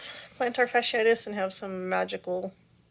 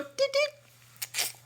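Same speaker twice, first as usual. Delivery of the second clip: high squeaky mock beeping; button beeps. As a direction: chirp it like a little button. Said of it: "you just reach over and go".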